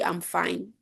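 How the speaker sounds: quiet, subdued claim to be okay; unconvincing reassurance. Shown as a demonstration. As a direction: say it softly, without conviction. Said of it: "Would you believe me?".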